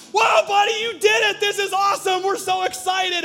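put-on high, loud cheering at baby's first step; excited cheering. Parents cheering their baby's first step, high excited shouts. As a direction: whoop it up like proud parents.

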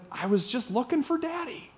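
put-on high, soft little-boy voice; innocent toddler. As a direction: answer like an innocent three-year-old. Three-year-old answers his dad.

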